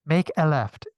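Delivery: loud, lightly imitated GPS prompt. GPS directs the hurried driver, imitated navigation voice. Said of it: "G P S was like".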